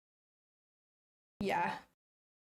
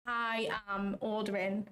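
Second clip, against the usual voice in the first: ordinary delivery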